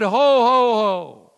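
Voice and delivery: loud, booming Santa laugh-call; Santa's booming ho ho ho. Retold or imitated. Pastor voicing Santa to terrified kindergartners, loud and booming. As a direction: boom it out like a jolly Santa. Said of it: "His voice was like the sound of many waters".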